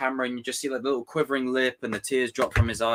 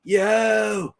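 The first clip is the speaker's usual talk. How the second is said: higher, loud stoner surfer; high laid-back surfer dude; 9 semitones higher, 4 dB louder, slower than usual. Retold or imitated. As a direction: whine like a chill surfer whose board broke. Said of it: "Great surfer impression".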